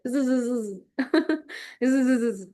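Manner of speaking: imitating a student's unclear buzzing mumble; mock mumbling. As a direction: buzz the words together without clarity. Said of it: "I've seen one of my student".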